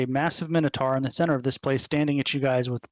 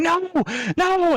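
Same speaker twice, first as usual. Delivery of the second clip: high, loud wail of "no"; screaming in protest; 5 dB louder than usual. Acted. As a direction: scream it like a caught cheater being dragged off. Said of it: "he starts screaming".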